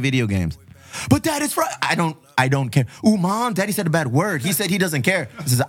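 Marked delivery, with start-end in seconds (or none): 1.1-1.7 high mock kid protest; whiny kid voice. Dad imitating his son, high whiny protest. 3.1-5.3 put-on tattling kid.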